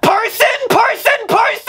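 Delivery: high, shouted repeated word; angry outburst. Acted. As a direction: explode with the word.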